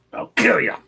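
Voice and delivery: high, loud clown threat; scary movie clown voice. Acted. Doing the voice to scare a girl, shrieked clown threat. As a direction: leap up in pitch as a menacing clown. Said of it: "do the voice just to scare".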